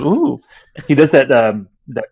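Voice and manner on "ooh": imitating [name]'s coo. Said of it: "and he goes".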